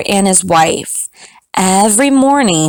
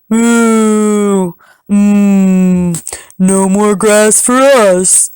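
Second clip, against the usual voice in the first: cattle moo voice; much slower than usual. Acted. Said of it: "they all cried".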